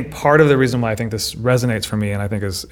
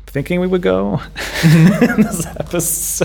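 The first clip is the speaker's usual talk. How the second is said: breaking into laughter, higher and slower; laughing; 9 semitones higher, slower than usual. Real, not acted. Host joking with co-host about the episode's direction, laughing. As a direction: let the words dissolve into a laugh. Hosts joke about having no plan.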